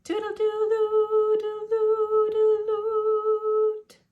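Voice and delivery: high, slow sung flute notes; little flute melody sung high; sung flute tune. A narrator's reading. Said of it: "played his flute".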